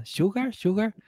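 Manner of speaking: "Sugar, sugar" high, with rising questioning tune; high, rising, accented "Sugar, sugar"; Miami-accented sugar. Retold or imitated. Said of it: "mi pronunciación, yo venía con mi sugar de Miami".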